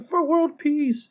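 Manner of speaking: put-on high, slow little-girl voice, solemn; little Anya voice. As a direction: echo it like a wide-eyed little kid. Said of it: "And she's like".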